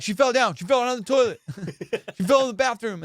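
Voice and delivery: high, over-excited retold explanation. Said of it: "I was a little excited".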